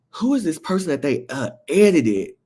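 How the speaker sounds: high-pitched retold puzzlement; puzzled. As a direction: replay your baffled double take. Retelling own puzzlement at photo.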